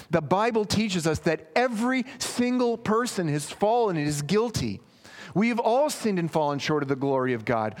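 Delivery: pushing the point with rising heat; fervent preaching. Acted. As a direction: preach it hard to the crowd. Preacher pressing guilt at full force.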